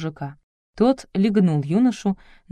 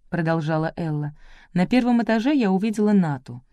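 plain reading voice

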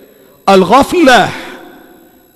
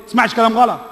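normal talking voice

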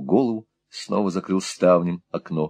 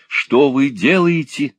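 exclamation; 6 semitones higher, 4 dB louder, slower than usual. A narrator's reading. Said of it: "воскликнул Николас".

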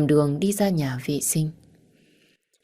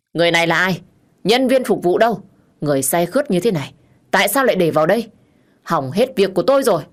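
louder, indignant outburst at an intruder; angry scolding; 2 semitones higher, 5 dB louder, faster than usual. Acted. Man scolding staff over a drunk intruder, angry. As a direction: demand answers from careless staff, voice raised.

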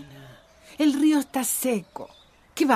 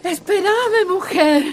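higher, louder breathless plea; out of breath; 7 semitones higher, 4 dB louder, slower than usual. Acted. Eugenia begging Tina to wait, out of breath. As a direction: gasp it out, exhausted. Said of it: "Ya no puedo más".

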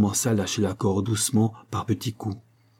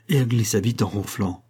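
plain reading voice

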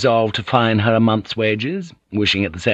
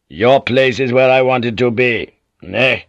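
firm, louder dismissive lord; commanding lord; 3 semitones higher, 5 dB louder than usual. A narrator's reading. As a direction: overrule her with authority. Lord asserting authority.

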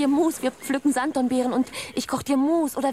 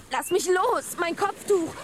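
pleading struggle; 9 semitones higher than usual. Acted. Husband grabs her.

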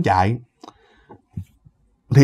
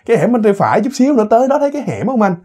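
higher, coaxing directions to the driver; a hint of a young woman's voice, pitched up; lightly voiced girl passenger; 6 semitones higher than usual. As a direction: lightly play a girl guiding him. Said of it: "nhỏ này nó mới vỗ vỗ vai ổng".

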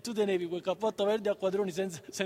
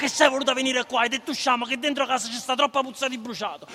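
urla, più acuto e forte; accusa gridata; 5 semitones higher, 7 dB louder than usual. Acted. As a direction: rinfacciarlo gridando. Figlio urla accusando la madre. (il figlio grida accusando la madre)

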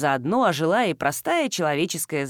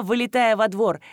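plain reading voice

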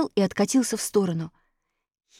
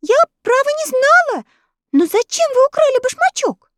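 put-on polite girl; 6 dB louder than usual. Elli answers the witch.

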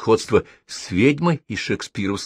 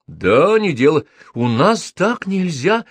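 slow, insistent repetition, stress on нас; stubborn; 9 semitones higher, 4 dB louder, slower than usual. A narrator's reading. Tenant stubbornly repeats his objection. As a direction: dig in and repeat it. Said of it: "упрямо повторил Райан".

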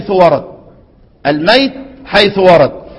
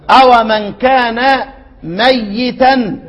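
slow tajweed recitation; drawn-out recitation; 4 semitones higher, slower than usual. Shown as a demonstration. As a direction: stretch ميتا long and slow. Teacher recites, drawing out the word.

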